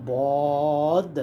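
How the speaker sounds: সদ with a long, full vowel; held four alif long. Shown as a demonstration. Teacher reciting a heavy letter for beginners, long and full. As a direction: draw সদ out heavily. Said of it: "চার আলিফ".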